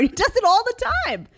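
high, loud exclamation; exasperated outburst. Real, not acted. Mom complains about her kid's trick, high loud exasperation.

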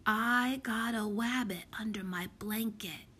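put-on small child's whispered secret, r said as w; lisping, hushed confession. A narrator's reading. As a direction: whisper it like a little one confiding a secret. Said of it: "she whispered".

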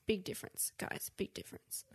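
soft, breathy whisper to the audience; whispered aside. Real, not acted. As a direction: lean in and whisper it like a secret. Stage aside to viewers.

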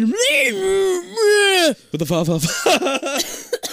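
high, slow hammed-up Italian-American; mock Italian-American gangster; Italian-American accent. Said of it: "The fucking accent".

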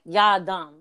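Y'all dumb said as "Ya dumb", no L, clipped New York; mock New York scolding. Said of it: "They say "ya," like".

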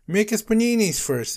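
high, loud demand; yelling. A narrator's reading. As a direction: yell it like insistent hungry kids. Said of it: "they yelled".

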